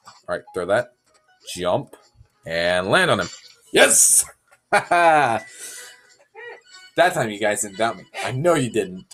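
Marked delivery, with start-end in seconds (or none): none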